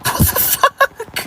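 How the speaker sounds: very high, breathy, drawn-out shock; stunned disbelief. Real, not acted. Driver hearing the car answer, squeaking in shock. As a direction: squeak it out slowly, half unvoiced.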